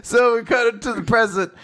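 helpless giggly admission; laughing. Real, not acted. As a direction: give up talking through the laughter. Host loses it laughing.

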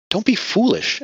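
alarmed gasp. A narrator's reading. Abby gasping in alarm at Martina's plan to run. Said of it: "Abby gasped".

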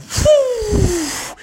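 high excited whoop; whoo. Acted. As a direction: swoop up with the ride. Bubby sails down.

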